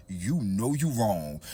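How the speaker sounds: slow, low reproach; retold knowing scolding; disapproving sister. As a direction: draw it out low like a warning. Sister's disapproving look and words.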